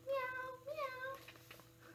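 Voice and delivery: imitating a cat-like bird call; catbird meow. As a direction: mew like a catbird. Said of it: "The catbird sounds like a cat".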